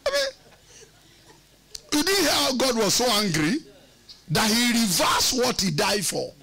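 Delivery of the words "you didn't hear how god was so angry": ordinary delivery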